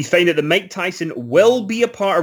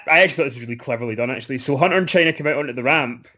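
ordinary talk, nothing marked

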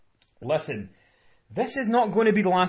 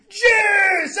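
high excited yell of a name; joyful shout; 8 dB louder than usual. Retold or imitated. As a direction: shout it as an old friend walks in. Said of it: "Paul's like".

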